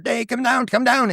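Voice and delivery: high-pitched retold summons; acted holler to the daughter; calling out. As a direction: call someone downstairs urgently. Calling his daughter downstairs.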